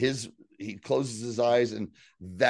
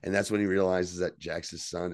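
ordinary talk

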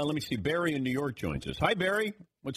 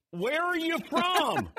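slow, high-pitched yelled question; punchline yelled high and drawn out; mock yell; much slower than usual. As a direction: holler it slowly, stretching every word. Joke punchline, question repeated louder, drawn out.

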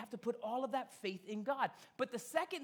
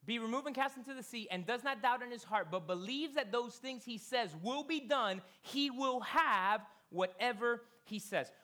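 preaching scripture; 3 semitones higher, 4 dB louder than usual. Acted. Rereading scripture with force.

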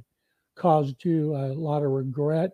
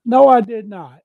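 emphatic no; 6 semitones higher, 10 dB louder than usual. Real, not acted. Blunt answer to friendly question.

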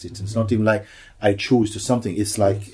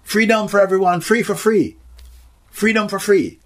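high, loud joyful proclamation; proclaiming; 12 semitones higher, 6 dB louder than usual. Retold or imitated. The voice proclaims freedom, high and loud. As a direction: ring it out like good news. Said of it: "And they say".

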